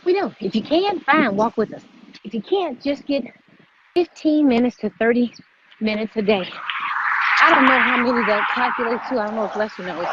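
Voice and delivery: normal talking voice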